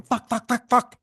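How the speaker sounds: rapid high-pitched mock scream; panicked repeated yelps, acted out; retold screaming. Officer scrambling away from the car, mock screaming. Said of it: "I'm screaming".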